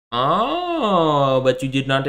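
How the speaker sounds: put-on French accent. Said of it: "the French will go like".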